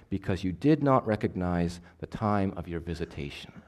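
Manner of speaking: measured, even Bible reading to a congregation; scripture reading. A narrator's reading. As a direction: read the verses steadily and clearly. Reads scripture aloud.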